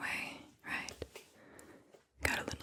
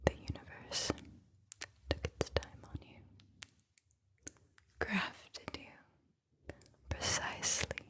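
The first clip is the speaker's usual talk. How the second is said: slow whispered verse with long pauses between phrases; whispered poem reading; 4 dB louder than usual. Acted. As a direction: whisper the poem phrase by phrase, letting each one settle. Reads a poem aloud.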